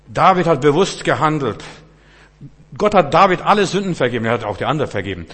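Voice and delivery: loud, high, driving sermon voice; forceful preaching. Acted. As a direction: hammer home David's sin from the pulpit.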